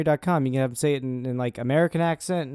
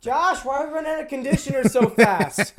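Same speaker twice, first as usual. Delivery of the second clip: high, accusing question; acted wife complaining; 11 semitones higher than usual. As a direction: quiz him like a suspicious partner. Comic skit between a couple.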